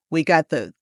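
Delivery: ordinary talk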